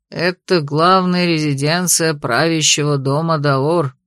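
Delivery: low, slow gentle father. A narrator's reading. Father speaking to toddler.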